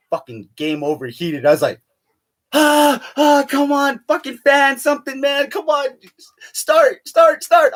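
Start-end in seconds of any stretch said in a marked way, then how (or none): 2.5-7.7 much higher, frantic pleading at the console; freaking out. Retold or imitated. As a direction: beg the overheated game to start. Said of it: "I'm all freaking out".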